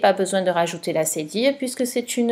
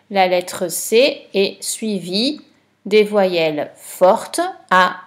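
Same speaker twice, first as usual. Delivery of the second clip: normal talking voice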